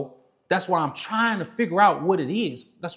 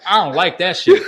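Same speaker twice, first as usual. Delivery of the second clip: laughing through a loud no; loud, amused refusal; 9 dB louder than usual. Retold or imitated. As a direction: laugh it off loudly while refusing.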